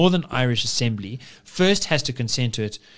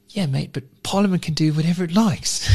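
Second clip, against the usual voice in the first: put-on a higher, lower class British accent; 4 semitones higher than usual. Quoting the upper class man's dismissive reply in a lower class British accent. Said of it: "I gave him, like, a lower class accent".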